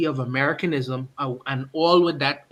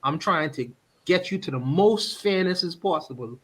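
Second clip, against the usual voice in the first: ordinary talk, nothing marked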